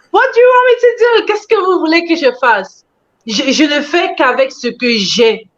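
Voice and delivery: high-pitched exasperated retort; exasperated plea. Retold or imitated. Creator answering endless complaints about accents, exasperated. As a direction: throw up a mock-helpless plea. Acting out exasperation at viewers' demands.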